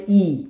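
ই held long; drawn-out ee. Shown as a demonstration. Teacher shows how Polish J sounds, long ই. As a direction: stretch ই into a long ee. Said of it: "একটু টান দেয়".

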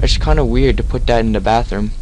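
normal talking voice

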